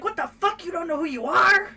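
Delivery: high, loud exasperated imitation; mock indignant outburst. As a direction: burst out in hammed-up disbelief. Acted indignant comeback in retold quarrel.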